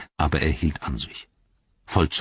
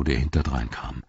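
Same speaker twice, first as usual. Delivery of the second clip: plain reading voice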